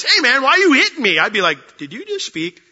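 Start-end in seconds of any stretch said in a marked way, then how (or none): 0.0-1.2 high, loud protest from a wronged donkey; mock complaining donkey. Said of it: "my donkey turns to me and says". 1.8-2.6 high, hushed disbelief; hushed, high-pitched mock fright; creeped out. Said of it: "I get creeped out real easily".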